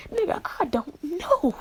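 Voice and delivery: high-pitched exasperated protest; exasperated bafflement. Acted. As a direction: throw the voice way up, at a loss. Baffled answer about his deep voice.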